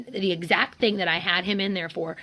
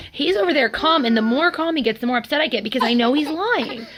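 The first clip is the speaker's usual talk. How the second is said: worked up, higher and louder; real exasperation; 6 semitones higher, 5 dB louder than usual. Real, not acted. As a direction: plead to be believed, voice rising. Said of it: "that just makes me crazy 'cause I need help".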